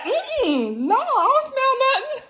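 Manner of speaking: very high, squeaky denial; fake innocence. Wife denying the smell to husband, very high innocent. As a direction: play dumb, all innocence. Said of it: "I be like".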